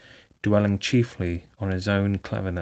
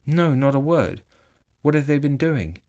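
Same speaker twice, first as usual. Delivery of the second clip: higher and louder, panicked; trembling; 6 semitones higher, 6 dB louder than usual. A narrator's reading. Toad trembles asking what the weasels did, panicked. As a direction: push for the answer in fear. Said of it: "trembling in every limb".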